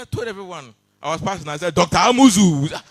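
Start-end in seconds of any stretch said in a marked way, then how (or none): 1.7-2.8 loud, high hailing shout; calling out. Acted. Passer-by greeting the doctor, loud high call. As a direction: holler a greeting as if passing by. Acted greeting called out in passing.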